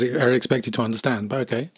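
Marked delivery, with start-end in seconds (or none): none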